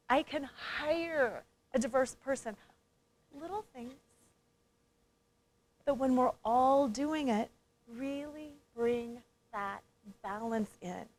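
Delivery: quiet workshop reflection voice; guided reflection. Acted. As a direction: ease the room inward, gently.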